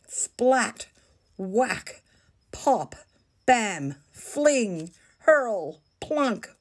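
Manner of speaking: high, playful splat words; playful sound words. A narrator's reading. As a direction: bounce each sound word. Poo fight sound words.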